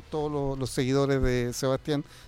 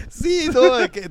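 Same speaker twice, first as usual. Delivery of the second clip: laughing fit, words squeezed out; can barely speak for laughing; hysterical laugh; 8 semitones higher, 8 dB louder, slower than usual. Real, not acted. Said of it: "Le, le di un ataque de risa al, al [name] ahora". (risa histérica)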